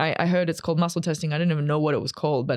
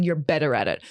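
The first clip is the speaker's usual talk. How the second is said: ordinary talk, nothing marked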